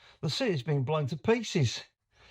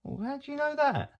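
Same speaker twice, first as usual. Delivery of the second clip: baffled question; 12 semitones higher than usual. Acted. Lestrade baffled by deduction.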